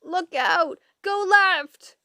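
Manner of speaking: high, loud screeched warning; urgent backseat shriek. A narrator's reading. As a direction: screech directions in alarm at the driver. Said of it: "middle screeched".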